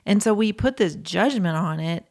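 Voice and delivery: usual speaking voice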